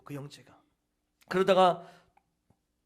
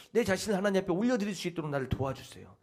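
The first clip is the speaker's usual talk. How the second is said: ordinary talk, nothing marked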